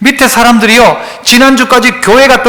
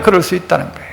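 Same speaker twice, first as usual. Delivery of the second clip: 그럴 repeated lower and much softer; soft echo; 3 semitones lower, 9 dB quieter, faster than usual. Shown as a demonstration. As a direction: murmur 그럴 수 있다는 거예요 back to yourself.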